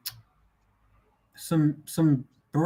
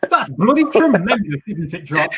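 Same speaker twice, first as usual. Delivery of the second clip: a lightly put-on British accent, a touch louder; playing the posh Brit for laughs; mock British accent; 5 dB louder than usual. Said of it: "the accent was driving me fucking crazy".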